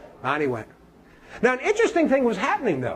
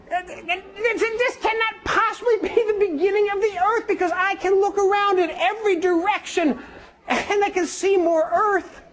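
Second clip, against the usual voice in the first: put-on high, earnest young man's voice; a puzzled young man pleading his point; young man's protest; 8 semitones higher, slower than usual. Young man doubting the wise men, high and earnest.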